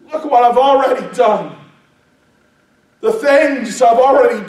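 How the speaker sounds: high, loud preacher's plea as Jesus; impassioned challenge. Acted. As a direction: build to a shouted, pleading question. Preacher voices Jesus challenging Peter.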